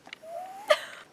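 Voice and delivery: high squealing giggle; a squeal of delight, real; laughing at a romantic line; giddy laugh. Real, not acted. Swooning over Rhys's vow.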